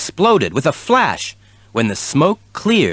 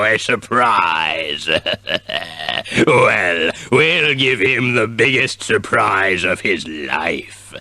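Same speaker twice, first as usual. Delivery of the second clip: villain voice; 4 semitones lower, slower than usual. A narrator's reading. Villain gloating in story.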